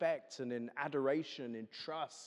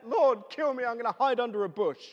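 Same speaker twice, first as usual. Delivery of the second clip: high, loud mock wail; despairing Elijah; 7 semitones higher, 5 dB louder than usual. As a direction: ham up a self-pitying prophet. Elijah despairing under a bush.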